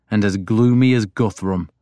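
usual speaking voice

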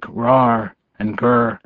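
slow mock growl noises; playful growl. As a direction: growl it out slowly like a pup. Boy imitates growling.

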